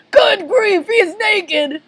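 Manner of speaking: high, loud horror; scandalized cartoon yelp; shocked exclamation. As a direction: blurt it out aghast. Shocked at naked Krabs.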